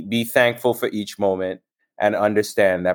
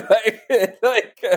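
high laughing words; 11 semitones higher than usual. Real, not acted. Laughing after the retold jolt.

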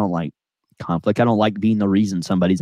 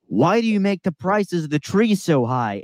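imitating an irate customer, raised and higher, slowly; angry customer; 9 semitones higher than usual. Customer glaring at the young worker, raised mock complaint. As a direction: mimic the rude customer's slow, pointed question. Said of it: "He's like".

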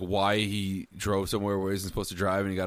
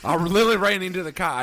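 loud Southern yell; 7 semitones higher, 6 dB louder than usual. Pretend.

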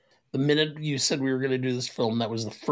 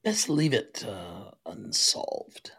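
mock British, whispered at the end; a hint of British, fading to a whisper; hushed British; 5 semitones lower, 4 dB quieter, slower than usual. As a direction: trail off into a low whisper. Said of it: "Thought he said".

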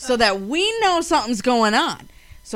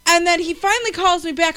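usual speaking voice

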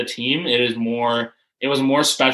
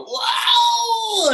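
very high, drawn-out imitated cheer; amazed shout. Grandparents learning of Olympic selection, shrieking. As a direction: let one long squeal of amazement soar.

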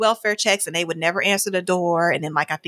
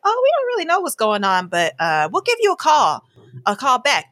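higher, chirpy customer-service voice; breezy HR rep; 5 semitones higher, 3 dB louder than usual. Retold or imitated. HR staff to the wife, a light chirpy customer-service voice. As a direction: brush her off brightly. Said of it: "They're like".

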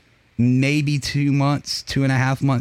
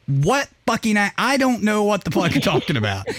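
higher-pitched mock outrage; exasperated rant; 6 semitones higher than usual. Speaker retorting to an accent compliment, mock exasperated.

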